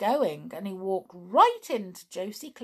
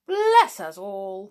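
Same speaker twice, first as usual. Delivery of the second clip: slow, loud bless us all; hearty exclamation; 8 dB louder, slower than usual. Acted. Captain exclaiming to Jolly, loud and slow. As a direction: boom it out in hearty surprise. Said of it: "cried the captain".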